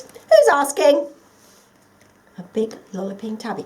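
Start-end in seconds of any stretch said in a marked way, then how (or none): 0.0-1.1 loud, higher, brash cat voice. Acted. A big tabby cat calls out to the lost toy, loud and brash. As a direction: boom it out like a big cheery tabby. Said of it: "said a loud voice".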